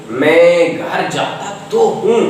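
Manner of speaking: slowly, with तो given the weight; slow stress on तो. Shown as a demonstration. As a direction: lean on तो, word by word. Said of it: "जोर देके बोलिए".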